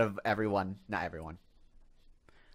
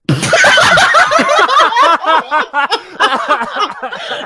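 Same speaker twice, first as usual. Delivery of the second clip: loud cackling burst of laughter; loud high laugh; 15 dB louder than usual. Real, not acted. [name] cracking up at the bullying, loud high laugh.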